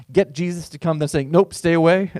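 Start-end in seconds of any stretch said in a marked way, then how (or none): 1.3-2.1 louder, playful mock refusal; playfully mocking the centurion; mock brush-off. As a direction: toss it off like a fussy host. Mocking centurion's mixed message.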